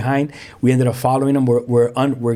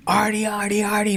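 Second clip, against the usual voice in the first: higher, softer call from a distance; calling his name; 6 semitones higher, 4 dB quieter than usual. Retold or imitated. Homeboy calling Artie back, higher distant call. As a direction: call him like a friend far off. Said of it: "my homeboy calling me".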